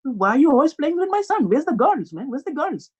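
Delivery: high, badgering Indian dad; high-pitched indignant Indian accent. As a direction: demand answers in a mock Indian accent.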